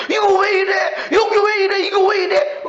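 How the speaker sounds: high, loud astonished outcry; amazed crying out. Retold or imitated. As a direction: marvel aloud, overwhelmed. Acting own astonished tears.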